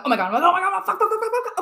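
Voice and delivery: high, loud, rapid-fire panic of excitement; excited freak-out. Retold or imitated. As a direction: reenact bursting with nervous energy. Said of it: "I got all this energy".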